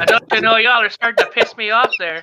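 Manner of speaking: testy warning; irritated old lady. Acted. Shopkeeper warns the laughing party, cranky.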